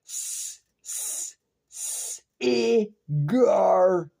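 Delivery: hissed s-, s-, s-, then a wailing drawn e-gar-et; stuttered syllables. Shown as a demonstration. Mocking how words are made, stretched and wailed. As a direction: break cigarette into hisses and a slow cry of e-gar-et. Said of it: "How do you say cigarette?".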